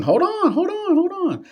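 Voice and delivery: very high hold on; squeaky hold on. Real, not acted. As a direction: squeal it in disbelief.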